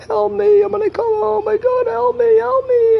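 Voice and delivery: high, loud panicked cries for help; mock cries for help. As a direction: wail for help like a trapped victim. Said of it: "you heard voices like".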